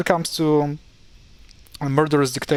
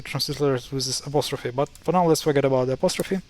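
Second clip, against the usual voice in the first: normal talking voice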